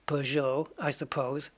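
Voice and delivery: ordinary talk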